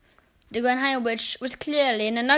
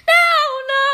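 high, desperate squeal; begging; 12 dB louder than usual. A narrator's reading. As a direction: plead in terror. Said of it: "begged the witch in the front row".

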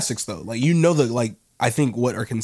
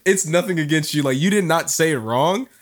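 helpless giggling, pitched higher; laughing apology; 5 semitones higher than usual. Real, not acted. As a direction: apologize through real laughter. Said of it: "the way you said it made me laugh".

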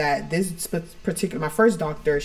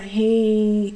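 emotionless hey; 5 semitones higher, 4 dB louder than usual. Retold or imitated. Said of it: "very emotionless".

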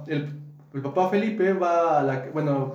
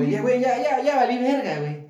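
mild mock panic, raised pitch; retold panic; 6 semitones higher than usual. Father cornered by Eusebio at the house, retold panic. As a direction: act out the father realizing he is doomed. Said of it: "que al señor le empezó a dar miedo".

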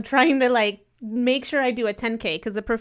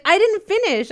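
high, louder indignant retort; getting angry; 9 semitones higher, 7 dB louder than usual. Retold or imitated. Runner insisting to race staff, high loud indignation. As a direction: insist you didn't finish, half laughing, half irritated. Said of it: "getting angry".

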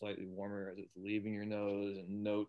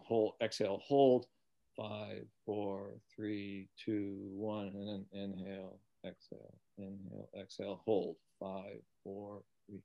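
breath counting demo. Shown as a demonstration. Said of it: "you can also do that as you're walking".

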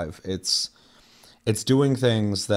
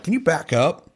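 imitated higher, louder request for space; gold panner; 9 semitones higher, 4 dB louder than usual. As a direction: put on the prospector's voice, pitched high. Prospector telling player off.

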